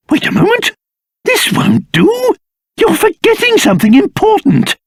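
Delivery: high-pitched, fussy interruption; urgent objection. Acted. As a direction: break in as if something vital was missed.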